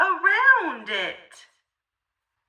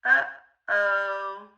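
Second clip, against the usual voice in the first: usual speaking voice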